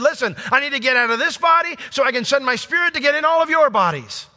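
high, loud, driving sermon climax; fervent preaching. Real, not acted. Pastor urging the church on, fervent and loud. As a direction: preach it with rising fire. Preaching builds to full force.

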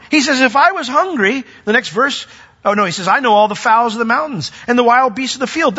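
high and loud, voicing God with fire; preaching at full force. Pastor voicing God to the church, high loud preaching. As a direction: thunder God's words over the congregation.

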